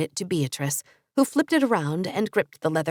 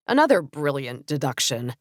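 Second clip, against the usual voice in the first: sarcastic; 7 semitones lower than usual. A narrator's reading. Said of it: "sarcasm dripping in her voice".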